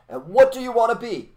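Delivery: teacher's call. Retold or imitated. Soviet teacher quizzing schoolkids, high and loud. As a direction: ring out the question to the class.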